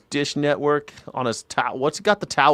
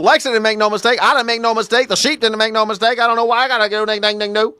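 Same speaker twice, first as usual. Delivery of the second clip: high hillbilly parody; mock country old-timer accent; 7 semitones higher, 4 dB louder, faster than usual. Host imitating the man denying his mistake, mock country accent. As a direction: spoof the old man's twang, pitched up. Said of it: "speak in that type of accent".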